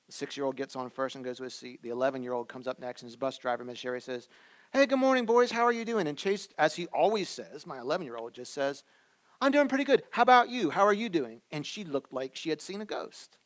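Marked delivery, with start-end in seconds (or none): 4.7-6.1 higher and louder, a bright sing-song greeting; cheerful bus driver. Retold or imitated. Bus driver greeting the boys, higher cheery call. 9.4-11.4 higher and louder, eager and friendly; polite boy. Retold or imitated. Eleven-year-old son answering the driver, higher polite voice.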